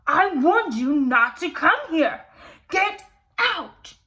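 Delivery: the beast roaring at Belle in rage; furious roar. Acted. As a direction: roar her out of the room. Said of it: "He was very angry".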